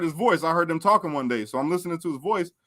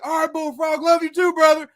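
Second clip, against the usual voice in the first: yard inmate; 11 semitones higher than usual. Retold or imitated. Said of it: "He's like".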